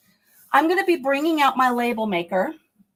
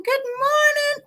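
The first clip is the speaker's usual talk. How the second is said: high sing-song hello; cheery greeting; slower than usual. Real, not acted. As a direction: greet her brightly, way up high. Greeting Grandma joining the stream.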